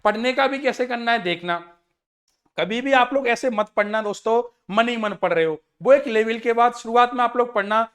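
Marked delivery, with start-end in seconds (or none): none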